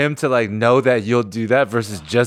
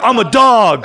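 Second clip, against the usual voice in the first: louder, higher boast of a fired-up fighter; hyped fighter; 8 semitones higher, 7 dB louder, slower than usual. Retold or imitated. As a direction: pump yourself up. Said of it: "You're like".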